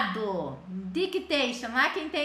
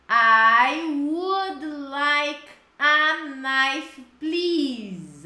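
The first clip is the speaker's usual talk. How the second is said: "I would like a knife, please" very slow and louder; slow model phrase; 7 dB louder, much slower than usual. Shown as a demonstration. Teacher modeling the answer, slow and loud. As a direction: drag each word out for the class.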